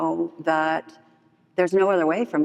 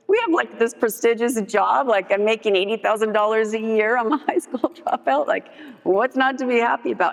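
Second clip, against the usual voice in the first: high-pitched, animated protest; indignant boasting; 5 semitones higher than usual. Retold or imitated. Proud dropout defending her job to a coworker, indignant. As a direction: defend it as if it's obviously wonderful.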